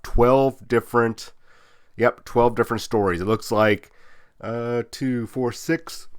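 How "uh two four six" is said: suppressing a sneeze mid-count; holding back a sneeze. Real, not acted. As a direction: hold in a sneeze while talking. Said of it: "Sorry, I'm trying not to sneeze".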